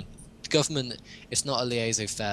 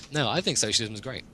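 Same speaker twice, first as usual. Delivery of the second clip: usual speaking voice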